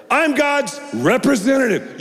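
I'm pitched high and ringing, then lands; proclaimed high. Real, not acted. Preacher proclaims to the congregation, high and ringing.

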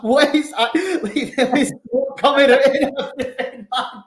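high, loud, laughing through the words; laughing disbelief. Retold or imitated. Telling the podcast host about the smoke, laughing high. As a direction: laugh in amazed shock. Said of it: "I was like".

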